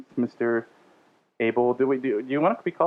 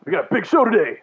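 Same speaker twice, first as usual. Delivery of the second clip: jacked-up, higher and louder announcer; radio voice; 5 semitones higher, 4 dB louder than usual. Pretend. Host hyping the show opening in over-the-top radio voice. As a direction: pump up the crowd like a wrestler. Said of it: "doing a more radio voice".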